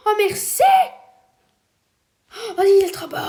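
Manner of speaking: excited cry. Real, not acted. Delighted at a stolen item.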